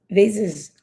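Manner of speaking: "This is" run together quickly; quick, joined-up "This is". Shown as a demonstration. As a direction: link "This is" without a gap. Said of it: "Cuando lo diga rápido".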